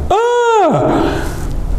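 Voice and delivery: high theatrical "Ah!"; mock panicked cry. Host pretends a child is lost, panicked cry.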